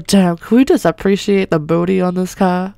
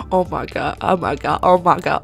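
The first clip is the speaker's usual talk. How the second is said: ordinary talk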